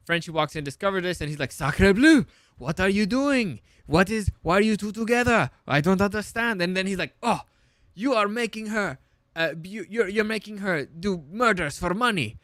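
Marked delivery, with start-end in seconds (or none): 1.5-6.6 high, loud mock French accent; put-on French bewilderment; Frenchie. Mimics Frenchie's accented shocked reaction. 7.2-12.4 higher-pitched put-on French accent; hammed-up French indignation; Frenchie. Mimics Frenchie accusing in accent.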